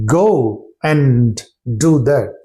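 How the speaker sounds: "Go and" said slowly, words apart; slow separate words. Shown as a demonstration. Teacher showing what natives never say, slowly. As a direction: drag out "Go and" unlinked. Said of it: "They don't say like that".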